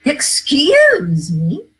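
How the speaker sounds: very slow, high, loud Excuse me, rising; emphatic demo phrase. Shown as a demonstration. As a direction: stretch out Excuse me and lift it high.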